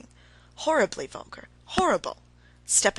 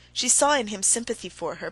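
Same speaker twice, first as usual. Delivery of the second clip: plain reading voice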